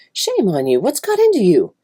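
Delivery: high, loud motherly scolding. Acted. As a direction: scold the child, voice leaping up on the question.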